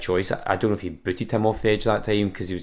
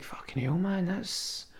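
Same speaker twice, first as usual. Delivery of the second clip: awed hush; 8 semitones higher, 11 dB quieter, slower than usual. Retold or imitated. Awed reaction to stories.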